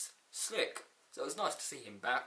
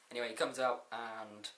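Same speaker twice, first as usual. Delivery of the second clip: usual speaking voice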